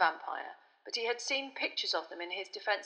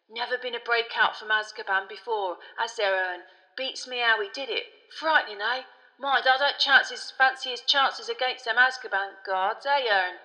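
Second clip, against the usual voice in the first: loud, excitable gossiping conductor; Stan the gossiping conductor; 4 semitones higher, 6 dB louder than usual. A narrator's reading. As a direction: relish a gory tale as the chatty conductor.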